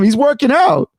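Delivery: usual speaking voice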